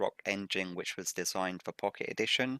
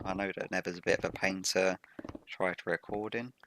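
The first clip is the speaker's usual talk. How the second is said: ordinary delivery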